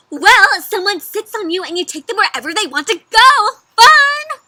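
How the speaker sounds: high, loud, gushing horse voice; super excited. A narrator's reading. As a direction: build to a squealed final word. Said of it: "horse sounds super excited".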